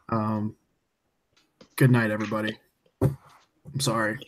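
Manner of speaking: drifting off while talking; nodding off. Real, not acted. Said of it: "I'm falling asleep".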